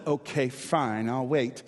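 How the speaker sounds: lightly voiced troll, low and slow; grudging troll. Troll letting the small goat pass, grudging. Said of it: "And so the troll says".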